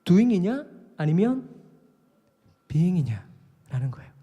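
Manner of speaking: very slow, weighed "doing"/"being"; contrast doing and being. Shown as a demonstration. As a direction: stretch "doing" and "being" wide apart to contrast them. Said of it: "영어로 표현하자면".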